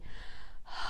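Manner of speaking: soft, controlled open sigh on ah; gentle, focused release of breath. Shown as a demonstration. Voice teacher demonstrating the right sigh, soft focused sigh. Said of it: "here's number three".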